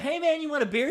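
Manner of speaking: high, over-friendly imitation; mock chummy. Imitating Mister J offering Brandon a beer, chummy. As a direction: offer it like a doting best buddy. Mimicking boss pampering his favourite worker.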